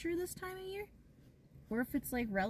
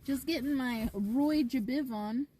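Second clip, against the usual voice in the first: usual speaking voice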